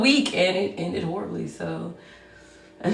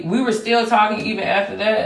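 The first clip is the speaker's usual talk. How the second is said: ordinary talk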